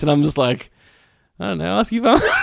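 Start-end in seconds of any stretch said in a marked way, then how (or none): 1.4-2.3 high, offhand dismissal; lightly brushing them off; dismissive brush-off. Retold or imitated. Retold dismissive reply.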